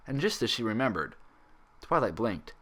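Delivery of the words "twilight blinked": usual speaking voice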